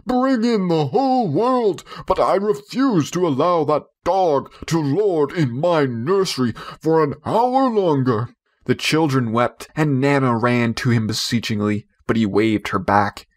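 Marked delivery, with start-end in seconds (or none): 0.1-8.3 high, blustering father laying down the law; defiant rant. A narrator's reading. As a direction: puff up and bluster at the family. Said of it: "father raging at the dog".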